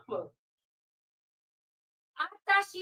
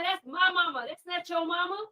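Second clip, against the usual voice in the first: ordinary delivery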